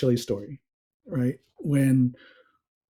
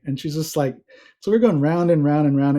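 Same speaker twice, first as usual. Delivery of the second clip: ordinary delivery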